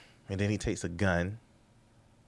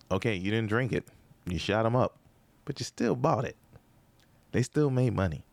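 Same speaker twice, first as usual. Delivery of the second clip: normal talking voice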